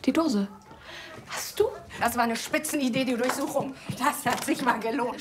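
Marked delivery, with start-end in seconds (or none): none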